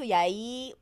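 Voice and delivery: ordinary talk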